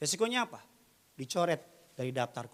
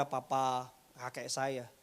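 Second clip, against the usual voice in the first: ordinary delivery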